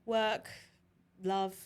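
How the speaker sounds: "Work, love." with northern English vowels. Shown as a demonstration. Demonstrating vowels used in Scotland, northern "Work, love.". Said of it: "vowels will go a bit more northern".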